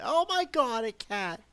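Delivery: high, loud burst of surprise; startled exclamation. Real, not acted. Streamer spots a cat mid-sentence, startled high squeal. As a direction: break off and squeal at a sudden sight.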